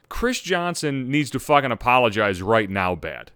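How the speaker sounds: normal talking voice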